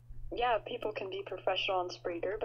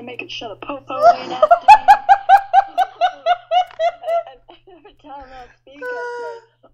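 mock gangster; 2 semitones higher, 13 dB louder than usual. Cohost mocks the DJ as a thug, laughing. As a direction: lampoon a wannabe thug. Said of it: "talks like that?".

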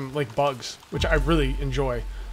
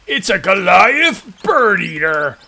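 slow, drawn-out dramatic reveal; ominous reveal; 5 dB louder, much slower than usual. Pretend. Husband revealing the spider to his wife, slow and ominous. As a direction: drop it like a horror line, slowly.

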